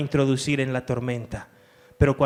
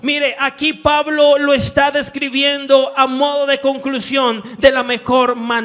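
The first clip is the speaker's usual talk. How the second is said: raised, loud scripture reading with driving force; fervent preaching; 9 semitones higher, 9 dB louder than usual. Acted. Pastor reading Paul's letters aloud to the church, fervently. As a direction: proclaim each verse to the back row.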